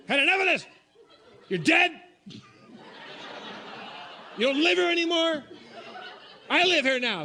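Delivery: loud, high-pitched outburst; mock exorcism yell. Storyteller acts out ordering a ghost out, high angry yelling. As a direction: yell at an unseen intruder, fed up.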